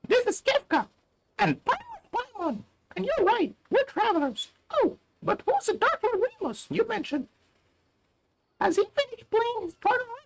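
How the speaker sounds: very high, squeaky sidekick voice; squeaky Paimon voice. Pretend. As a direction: squeal it like a tiny mascot. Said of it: "I was trying to do Paimon".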